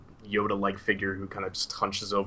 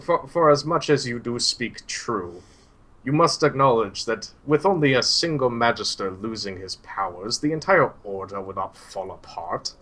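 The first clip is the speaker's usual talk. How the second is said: put-on a kindly old mentor, animated and raised; old Suhail; 5 dB louder than usual. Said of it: "and he's like".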